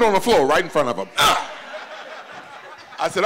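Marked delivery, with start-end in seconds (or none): none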